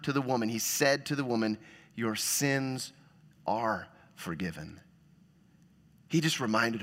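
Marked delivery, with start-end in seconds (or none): 1.9-4.8 tender Jesus. Retold or imitated. Jesus voiced to woman.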